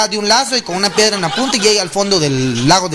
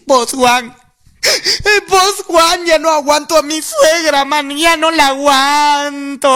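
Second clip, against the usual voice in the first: high, mock-crying friend, last word dragged out; hammed-up tearful wail; 6 semitones higher, much slower than usual. Friend complaining to Juan about his mother-in-law, mock crying. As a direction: wail it pleadingly as if sobbing.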